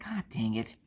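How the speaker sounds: quiet grumbling curse; muttered frustration. Retold or imitated. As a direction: grumble it under your breath.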